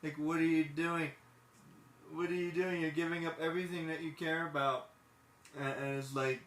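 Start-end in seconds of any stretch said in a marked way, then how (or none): 0.0-4.9 dismayed outcry. Retold or imitated. Urging Riley not to give up everything, high and dismayed. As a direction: plead with her not to quit. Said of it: "So I'm like".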